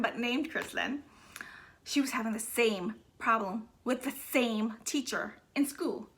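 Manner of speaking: normal talking voice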